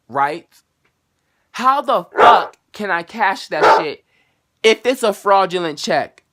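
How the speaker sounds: loud, high and slow rant; angry rant. Real, not acted. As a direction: lecture the bank like it's stupid. Ranting at the bank.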